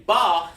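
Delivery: BA said as one separate sound; standalone syllable. Shown as a demonstration. Teacher shows students a syllable that stands alone, separated. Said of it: "It's just one sound".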